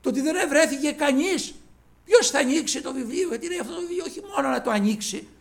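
loud, high, pressing pulpit delivery; preaching at full force. Acted. Preacher telling the church John wept, urgent loud preaching. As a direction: drive home that no one could open it.

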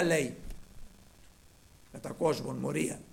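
ordinary talk

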